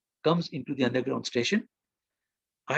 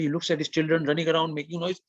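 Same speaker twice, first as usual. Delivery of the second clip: normal talking voice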